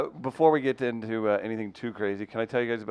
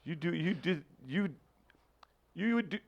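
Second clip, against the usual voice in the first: high, cracking wounded whine; heartbroken guy; 9 semitones higher, 10 dB louder than usual. Acted. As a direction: play a betrayed lover in disbelief. Acts a hurt man's outburst.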